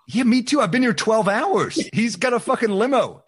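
high, loud exasperated comeback; exasperated retort. Retold or imitated. Comic to backstage crew, an exasperated loud retort. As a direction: throw it back, fed up and loud.

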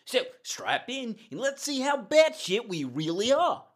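overdone Australian, high and loud; bad Australian accent. Co-host welcoming listeners in a hammy Australian accent. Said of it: "that really bad, like, Australian English accent".

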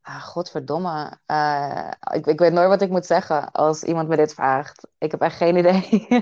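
speaking natural Dutch; native Dutch. Shown as a demonstration. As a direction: answer casually in native Dutch, a little hesitant. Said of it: "say something in your native tongue".

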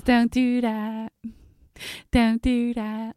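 slow, drawn-out warning in an imitated voice; measured, repeated mock warning; Cindy. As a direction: stretch each word like a friend near breakdown. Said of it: "That's how she talks".

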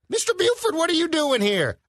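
high-pitched mock-astonished greeting; mock surprise. Mimics a startled character's greeting.

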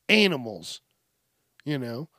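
ordinary talk, nothing marked

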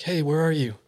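mild retold call-out. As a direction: imitate calling for someone lost. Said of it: "So they call it again".

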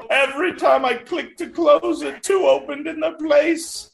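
high, wailing mock excuse; frantic old woman. Old woman excusing the pop-ups, hammed-up frantic wail.

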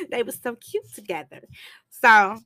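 ordinary delivery

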